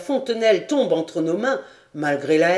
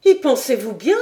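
higher, louder cry of alarm; exclaiming; 6 semitones higher, 5 dB louder than usual. A narrator's reading. Clara alarmed at his plan, crying out higher and louder. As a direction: cry out in dismay. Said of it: "s'écria Clara".

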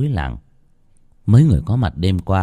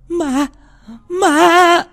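very high shriek, swelling louder; frightened cry building to a piercing yell; terrified scream; 7 dB louder than usual. A narrator's reading. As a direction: scream in horror at a ghost. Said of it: "Tiếng hét của bà Hương".

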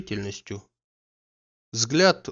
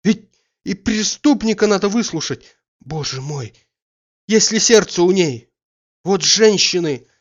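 despairing outburst; 7 semitones higher, 8 dB louder than usual. Acted. Despairing outburst after she leaves.

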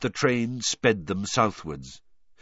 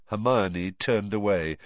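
plain reading voice

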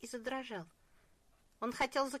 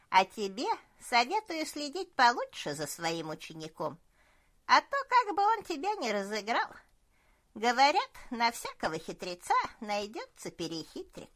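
put-on loud, smug old cook; jovial old cook; 7 dB louder than usual. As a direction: boast like a fat, pleased old cook. Cook gives advice.

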